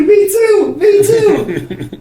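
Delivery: high, loud laughing yell; older host cracking up on stage; laughing shout. Retold or imitated. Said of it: "Devin's dying laughing".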